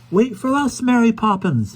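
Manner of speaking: high, loud call. A narrator's reading. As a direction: shout after her across the wind. Children running after her.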